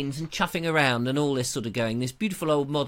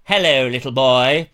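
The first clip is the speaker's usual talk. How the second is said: louder, looming voice from up on stilts; creepy giant greeting; 2 semitones lower, 8 dB louder than usual. Retold or imitated. Stilt walker towering over a child, looming louder greeting. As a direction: loom over a child, hammed-up.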